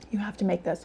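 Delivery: talking with a mouth full of food. Real, not acted. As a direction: say it while still chewing. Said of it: "I'm talking with my mouth full".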